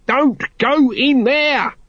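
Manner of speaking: high, drawn-out warning cry; slow, high-pitched yell of alarm; alarmed shout. Acted. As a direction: call out desperately to stop him. Nobby calls Carrot back in alarm.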